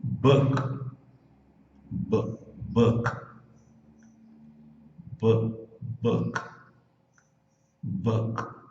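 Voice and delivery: Book with the K nearly silent; killed K. Shown as a demonstration. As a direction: swallow the K at the end of Book. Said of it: "você mata o som do K".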